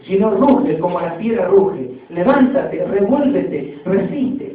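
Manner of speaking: loud, declaiming poetry reading; poem recitation. Acted. Preacher reciting an inspiring poem to the congregation, loud declamation. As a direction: proclaim the verses like a rallying cry.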